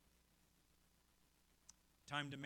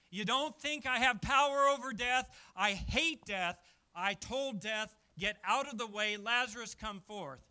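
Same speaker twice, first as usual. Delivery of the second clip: loud, higher, defiant declaration; defiant Jesus; 5 semitones higher, 14 dB louder than usual. Acted. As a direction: shout down death itself. Preacher voicing Jesus defying death.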